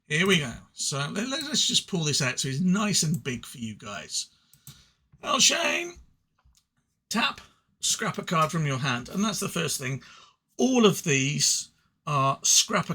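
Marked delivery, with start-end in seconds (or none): 5.2-7.4 "El Shane" announced much higher, in a put-on voice; hammed-up high fanfare on "El Shane"; grand announcement. Shown as a demonstration. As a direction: proclaim "El Shane" like a ring announcer. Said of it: "I think that's how it's pronounced".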